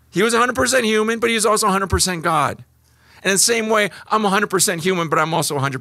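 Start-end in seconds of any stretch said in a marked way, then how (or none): none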